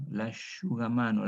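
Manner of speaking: "L'asciugamano" with sc as sh, lashugamano; Italian sh example. Shown as a demonstration. Teacher gives "L'asciugamano" as an Italian sh example. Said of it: "la sh del italiano".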